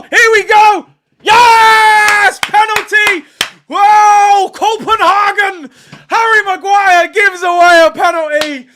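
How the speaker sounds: high, loud cheering at the penalty; excited shout. Real, not acted. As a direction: yell it out as the penalty is given. Penalty given while watching live.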